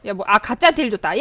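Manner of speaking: ordinary delivery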